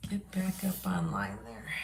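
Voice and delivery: ordinary delivery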